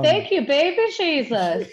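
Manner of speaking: high, loud, drawn-out thanks through laughter; laughing mock praise. Real, not acted. Guest joking that she isn't cursed, laughing mock praise. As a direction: cry out joking relief while laughing.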